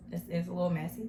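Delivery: ordinary delivery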